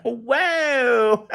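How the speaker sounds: high, startled cry of whoa; overwhelmed whoa. Retold or imitated. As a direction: let the emotion burst out in one high whoa. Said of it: "I was like".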